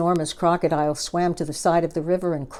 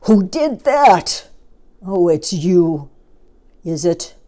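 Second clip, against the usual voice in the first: big deep voice; 7 dB louder than usual. Acted. Trunky the elephant booming at the crocodile. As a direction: boom down like a huge elephant. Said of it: "in his big, deep voice".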